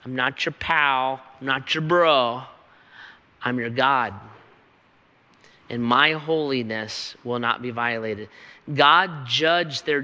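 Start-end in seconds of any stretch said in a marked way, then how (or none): none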